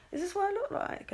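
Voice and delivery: small, high, soft disbelief; mock dismay. Seeing herself on Snapchat, quiet mock dismay. Acting dismay at own filtered face.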